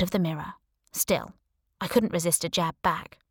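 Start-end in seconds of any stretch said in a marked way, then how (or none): none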